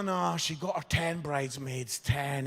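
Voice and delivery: ordinary talk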